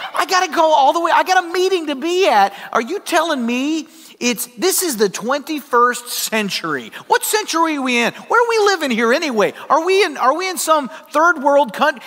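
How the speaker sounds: high, indignant phone rant; worked up. Retold or imitated. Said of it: "I'm getting worked up".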